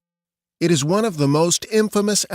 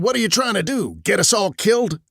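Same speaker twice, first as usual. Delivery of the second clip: high-pitched, urgent scold; sharp rebuke, pitched well up; 7 semitones higher, faster than usual. Acted. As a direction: tell off a reckless youngster. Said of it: "I stopped him and scolded him".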